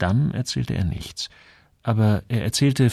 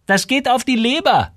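very high, louder gossiping voice; judgemental shopkeeper woman; 5 dB louder than usual. A narrator's reading. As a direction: pitch it way up, passing judgement as the shopkeeper. Shopkeeper Frau Enström passes judgement. (hohe, vorwurfsvolle Ladenfrau)

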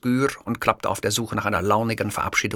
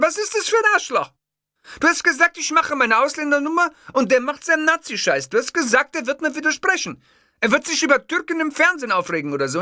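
high, loud outraged ranting; furious rant; 4 dB louder than usual. Acted. Angry host ranting at his producer Carmen. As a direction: spill it out in a frantic tirade.

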